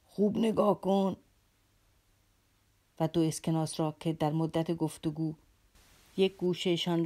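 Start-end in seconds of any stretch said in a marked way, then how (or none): 0.0-1.2 slow, squeaky strange voice; squeal. A narrator's reading. Captain suddenly squeals at Alyosha to look, slow and squeaky. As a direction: squeal it out slowly. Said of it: "سروان ناگهان قیش قیش کرد".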